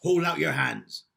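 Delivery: loud, firm royal command; commanding king. Acted. Fairy king commanding Rosie, loud and firm. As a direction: order her to hold out her hands.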